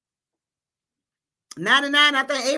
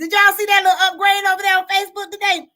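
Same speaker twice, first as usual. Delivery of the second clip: loud, fast, higher hype call; excited hype; 7 semitones higher, 7 dB louder, faster than usual. Real, not acted. Host hyping her live viewers about a Facebook upgrade, loud and fast. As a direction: fire up the viewers.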